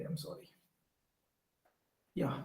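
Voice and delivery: normal talking voice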